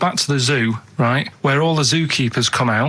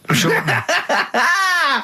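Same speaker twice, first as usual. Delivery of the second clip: laughing; 12 semitones higher, much slower than usual. Real, not acted. [name] laughing while defending himself.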